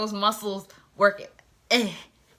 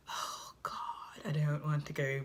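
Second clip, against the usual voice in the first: reluctant whisper; 6 semitones lower, 13 dB quieter than usual. Retold or imitated. Girl dreading the date.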